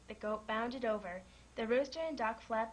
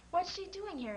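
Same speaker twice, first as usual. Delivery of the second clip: high startled question; surprised exclaim; 8 semitones higher than usual. A narrator's reading. Farmer sees his cow arrive at the fair, startled and high. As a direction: blurt it out, caught off guard. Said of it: "exclaimed Mister Larson".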